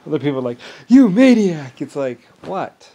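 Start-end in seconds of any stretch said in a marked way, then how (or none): 0.9-1.8 loud, high-pitched accusation; mock accusing shout. Other people accusing him of being a maniac, mock accusing shout. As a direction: mimic people yelling in outrage.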